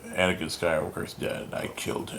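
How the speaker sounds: softly imitated villain, dropping away at the end; quiet, lightly voiced menace; Darth Vader. Vader answering Padme's ghost, hushed villain impression. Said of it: "The Darth Vader comic".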